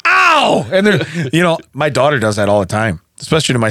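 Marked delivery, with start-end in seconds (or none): 0.1-0.7 fake ow. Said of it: "you're like".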